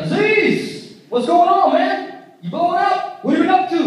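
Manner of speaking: high-pitched, hammed-up chummy greeting; talking to little brother. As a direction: imitate a big brother playfully hailing a kid. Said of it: "talking to me like a little brother and niece".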